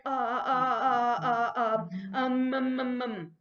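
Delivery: drawn-out, hesitant filler sounds; mumbling demo. Shown as a demonstration. Teacher showing students how to mumble, hesitant mumbling. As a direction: demonstrate stalling with slow, repeated uhs and ums. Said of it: "That's when you mumble".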